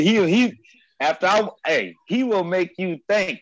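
normal talking voice

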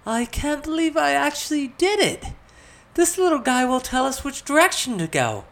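very high, loud elated squeal of words; delighted excitement. A narrator's reading. Elizabeth amazed her spell worked, thrilled high voice. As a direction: burst out thrilled.

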